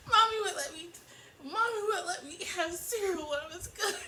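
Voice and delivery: hammed-up petulant boy; petulant child. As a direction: whine like an immature child, on the edge of laughing. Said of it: "how he sounds petulant and immature".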